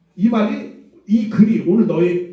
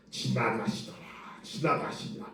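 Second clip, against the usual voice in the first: hushed holy scripture tone; 6 semitones lower, 14 dB quieter than usual. Shown as a demonstration. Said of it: "거룩하게 표현해줘야 되니까".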